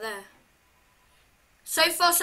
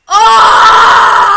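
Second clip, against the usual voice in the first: very loud, high scream of fright; terrified scream; 5 semitones higher, 20 dB louder than usual. Real, not acted. Player caught by the jump scare, terrified scream.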